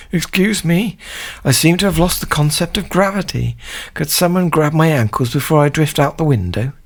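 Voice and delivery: high-pitched, politely distressed voice; plaintive floating man. Acted. Man stuck by the ceiling asking for help, high-pitched polite plea. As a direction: plead for help in a silly high voice.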